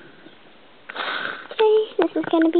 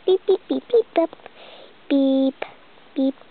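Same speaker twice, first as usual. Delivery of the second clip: phone beeps. Pretend. Pretend phone dialing tones.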